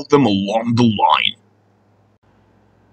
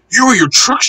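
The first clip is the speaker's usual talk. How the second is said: cheeky little engine; 11 semitones higher than usual. A narrator's reading. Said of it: "said Little Tank Engine".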